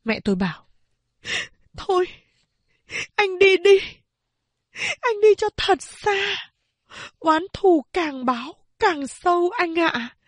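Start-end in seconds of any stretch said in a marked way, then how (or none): 1.8-10.2 giọng cao, đau đớn, to dần; khóc tiễn người con rể đi xa; nghẹn ngào khuyên. Acted. Mẹ khóc giục đi xa. (mẹ vừa khóc vừa giục anh đi thật xa)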